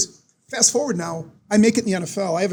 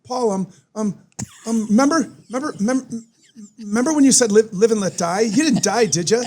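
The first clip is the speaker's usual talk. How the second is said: stammering, nervous fan voice, a bit higher; nervous Farley; 3 semitones higher, 3 dB louder than usual. Retold or imitated. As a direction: fumble it like a starstruck Chris Farley. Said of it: "Chris Farley's all intimidated".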